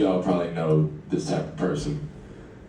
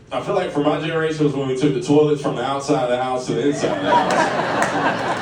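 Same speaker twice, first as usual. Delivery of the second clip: stoned dad; 6 semitones higher than usual. Retold or imitated. Dad after a blunt muses, high mock voice. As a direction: play the high dad drawing out "house".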